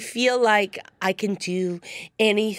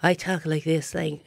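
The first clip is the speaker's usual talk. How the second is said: lower, softer sultry voice; 6 semitones lower, 4 dB quieter than usual. Shown as a demonstration. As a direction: drop low and purr it softly. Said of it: "when I play, like, a sultry person".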